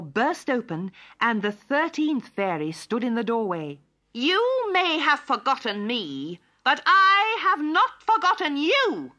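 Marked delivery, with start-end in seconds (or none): 4.1-9.1 high, loud, slow vengeful shriek; wicked fairy's shriek. A narrator's reading. Forgotten fairy confronts the court, shrieking high. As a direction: shriek it, climbing higher and louder. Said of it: "she shrieked".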